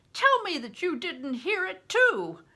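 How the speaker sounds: high, loud, beaming; delighted exclaim. A narrator's reading. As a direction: share the joy loudly. Bear delighted by the song.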